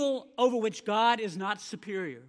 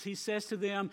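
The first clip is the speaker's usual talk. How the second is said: usual speaking voice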